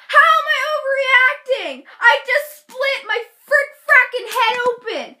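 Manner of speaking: high, loud mock outrage; indignant outburst. Hurt girl to doubting friend, hammed-up loud outrage. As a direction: retell a shrieked protest.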